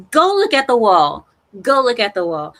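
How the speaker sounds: loud, pretend-strict order; mock scolding. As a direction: send the kids to the wall. Mock scolding chat like kids.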